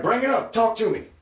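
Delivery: very high, insistent mimicry; acted stranger. Stranger pressing a shopper in Target, high insistent mimicry.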